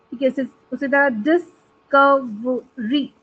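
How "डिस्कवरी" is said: Discovery slowly as डिस-क-व-री; syllable by syllable. Shown as a demonstration. Teacher showing four syllables in a word, syllable by syllable. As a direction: separate all four beats of discovery. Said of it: "four syllables आ रहे हैं".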